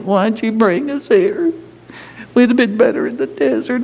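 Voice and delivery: high whining complaint; mock the grumbling people, high and whiny; whiny Israelites. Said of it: "What'd they do?".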